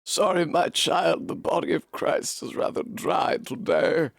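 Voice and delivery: whispered priestly confession voice. Acted. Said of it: "this is my priest voice".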